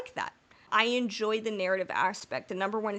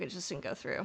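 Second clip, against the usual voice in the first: ordinary talk, nothing marked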